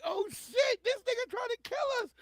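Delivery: high, loud mock scream of panic; wife's panicked shout. Retelling his wife's panic on the mountain, high shout. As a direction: shriek it like the car's about to go over. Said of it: "She was like".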